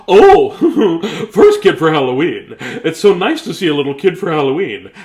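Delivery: loud, laughing greeting; high delighted laughing welcome; laughing lady. Acted. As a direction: gush at the kid through a laugh.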